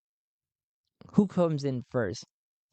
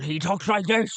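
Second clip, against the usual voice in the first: higher, rambling pirate voice; Madman Gotul; 5 semitones higher than usual. Shown as a demonstration. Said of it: "He's very ramble, ramble".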